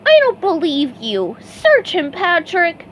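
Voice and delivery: high, loud commanding sponge; ordered. A narrator's reading. SpongeBob ordering Patrick, high loud command. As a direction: give the order sharply. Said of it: "SpongeBob ordered".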